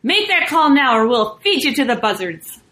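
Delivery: loud, forceful guerrilla threat; rough threat. A narrator's reading. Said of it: "roughly this time".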